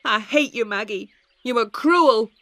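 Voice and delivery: loud hurt accusation; angry boy. A narrator's reading. Tom telling his sister he hates her, angry. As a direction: spit out the words at her. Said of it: "Tom shouted".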